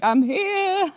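high, sing-out announcement; mock grand arrival. Joking about turning up in an Uber, mock grand arrival. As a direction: announce yourself like a joking diva.

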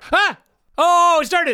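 sudden high yelp of surprise; surprised. Real, not acted. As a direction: caught off guard as it starts. Video suddenly starts.

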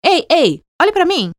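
mock call for attention. Shown as a demonstration. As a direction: grab someone's attention, half-playful. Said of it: "É tipo um".

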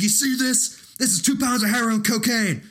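high, loud tough-guy voice; hammed-up cop bravado; Tom Sizemore impression. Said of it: "he's just going like".

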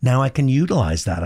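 ordinary talk

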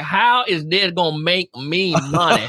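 slow, hammed-up Southern drawl; Southern US accent. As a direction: drag it out like a skeptical sales leader from the South. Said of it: "They talk like that".

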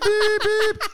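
high, loud electronic beeping; imitated computer beep. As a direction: beep like a crashing Windows screensaver. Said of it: "the whole system goes beep".

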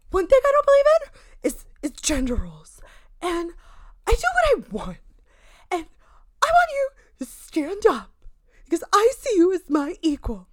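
high, breathless, overdramatic woman; breathy exhausted impression. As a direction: gush it out like she's out of breath. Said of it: "You're really trying with this impression".

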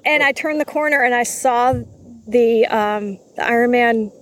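usual speaking voice

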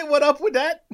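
squeaky high mock echo, laughing; mock teasing echo. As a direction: tease the line back in a high voice. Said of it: "You can't say".

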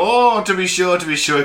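loud, high Irish lilt on to be sure; playful stage Irish accent. Retold or imitated. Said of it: "someone will go".

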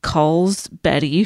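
ordinary delivery

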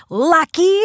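sudden high loud shout; shriek. Retold or imitated. Little girl in the car screaming at the stranger, loud and high. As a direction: scream it out of nowhere. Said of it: "turns and screams".

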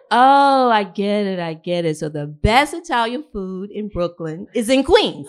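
loud, emphatic punchline; imitated dawning realization. Friend realizes best food is in Queens, loud realization. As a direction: belt it out as it dawns on you.

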